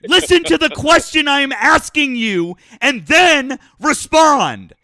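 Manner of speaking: high, loud furious yelling; angry yelling. Real, not acted. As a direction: yell him into silence. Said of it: "from all that yelling".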